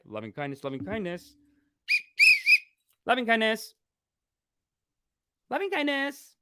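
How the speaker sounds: rising sing-song self-soothing mantra; calming himself. Real, not acted. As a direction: chant it to calm yourself down. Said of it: "Sorry that I sound angry".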